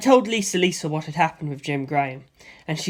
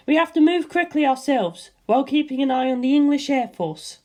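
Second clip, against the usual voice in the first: a raised, cool and thoughtful woman's voice; Lisa Lisa's voice; 8 semitones higher than usual. A narrator's reading. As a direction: voice the calm Hamon master, puzzling it out. Lisa Lisa orders the warriors.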